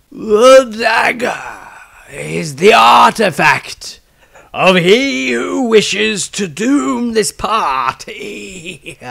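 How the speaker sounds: booming prophecy. Acted. Magister proclaims his vision to the council, booming and slow. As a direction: intone it like a seer lost in a trance. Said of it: "He spoke aloud".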